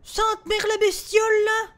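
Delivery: loud, high, slowed shout of fright; scared shout. Real, not acted. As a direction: yell it as the creature appears. Le fantôme apparaît soudain. (cri de frayeur fort et aigu, ralenti)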